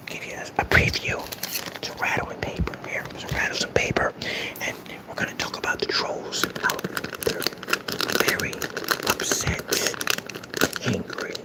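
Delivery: mock soothing ASMR whisper, taunting. Shown as a demonstration. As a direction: breathe the words right into the mic. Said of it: "This is A M S R for trolls".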